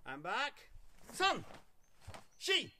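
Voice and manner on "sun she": high, loud count call; drill count. Real, not acted. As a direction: call the count sharply to the class.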